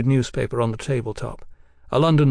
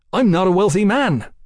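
indignant; 7 semitones higher, 5 dB louder than usual. Acted. Accused man protests.

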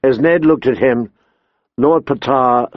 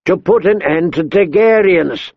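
growled; 6 semitones higher than usual. A narrator's reading. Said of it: "the king growled".

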